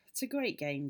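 wistful little sigh. A narrator's reading. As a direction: sigh first, then speak low-key. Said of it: "sighing a little".

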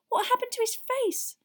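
put-on a girl's high, urgent question; Hermione's whisper. A narrator's reading. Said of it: "Hermione whispered".

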